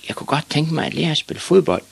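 put-on high-pitched little-boy voice; hopeful boy. A narrator's reading. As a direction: ask the bigger boys to join in. Boy shyly asks to join.

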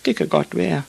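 put-on high little boy's voice; meek high-pitched child; small boy Ivan. A narrator's reading. Small Ivan answers meekly.

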